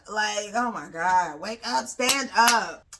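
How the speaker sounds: slow, loud, drawn-out plea; exasperated wake-up call. Pretend. Host to anyone falling for Diamond, exasperated loud plea. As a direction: stretch each word out loudly, shaking sense into someone.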